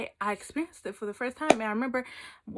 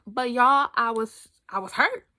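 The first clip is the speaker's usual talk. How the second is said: normal talking voice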